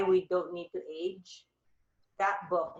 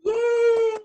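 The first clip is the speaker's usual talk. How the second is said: high, loud drawn-out cheer; real squeal of delight; 10 dB louder than usual. Real, not acted. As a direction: cheer it out, thrilled. Delighted reaction to a compliment.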